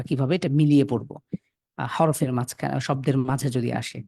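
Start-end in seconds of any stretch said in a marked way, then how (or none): none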